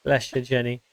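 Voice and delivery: usual speaking voice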